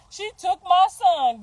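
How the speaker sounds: slow, high, loud mock whine; slow hammed-up whine of a jealous woman; mocking imitation. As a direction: drag it out like a wounded rival. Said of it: "like I'm".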